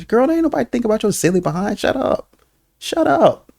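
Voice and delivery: high-pitched mock telling-off; comic outburst, voice leaping way up; exasperated scolding. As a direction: scold her like she's being ridiculous.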